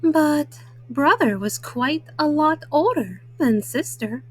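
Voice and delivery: usual speaking voice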